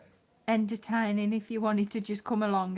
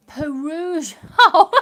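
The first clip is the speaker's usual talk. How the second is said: laughing; 9 semitones higher, 17 dB louder than usual. Real, not acted. Laughing at own mispronunciation.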